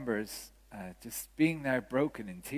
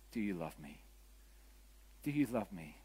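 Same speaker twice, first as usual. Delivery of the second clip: soft, lower, tender question; gentle asking; 5 semitones lower, 9 dB quieter than usual. Acted. Jesus asking the listener for love, gently. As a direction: ask it softly, as Jesus would.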